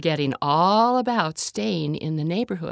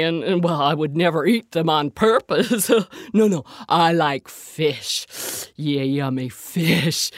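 easygoing bear, relishing food; gentle bear person; 4 dB louder than usual. A narrator's reading. Bear keeps talking.

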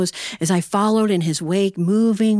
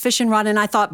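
ordinary talk, nothing marked

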